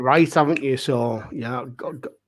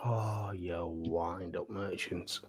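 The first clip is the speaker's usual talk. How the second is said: slow, breathless whisper; whispered grumble; 9 semitones lower, 18 dB quieter, much slower than usual. Real, not acted. Picked first, contestant whispers a mock complaint. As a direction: mutter it under your breath.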